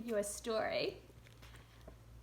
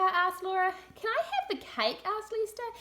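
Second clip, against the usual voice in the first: put-on lively read-aloud for young children; storybook reading; 2 semitones higher, 7 dB louder than usual. A narrator's reading. Teacher reading a story to room two, lively storybook voice. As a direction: read it like a picture book to a class.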